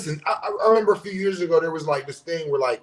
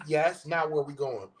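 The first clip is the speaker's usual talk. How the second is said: ordinary talk, nothing marked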